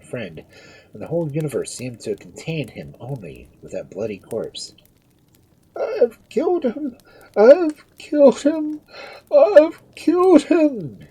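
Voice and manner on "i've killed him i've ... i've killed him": very high, slow wail, building louder; fearful shrieks. A narrator's reading. Duke over his friend's corpse, fearful shrieking. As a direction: shriek it in horror, drawing out each cry. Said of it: "His fearful shrieks soon drew the persons".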